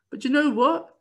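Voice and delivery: high, punchy question; excited. Real, not acted. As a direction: tease the big reveal. Teasing rhetorical question.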